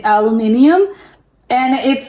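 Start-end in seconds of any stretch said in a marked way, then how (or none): 0.0-0.9 "aluminium" in the British form, ending in ee-um; "aluminium" with the extra ee before the final um; British pronunciation. Retold or imitated. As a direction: imitate the British word "aluminium", feeling out each syllable. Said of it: "You guys call it".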